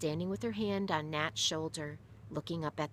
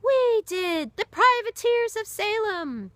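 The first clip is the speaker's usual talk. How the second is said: high, loud cheering answer; roaring crowd; 11 dB louder, slower than usual. Acted. Sailors roaring their answer to the barrel sailor, high and loud. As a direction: roar it back as a proud crowd. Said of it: "The others roared the answers".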